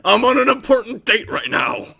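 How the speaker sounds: annoyed god. Acted. Voicing the called god.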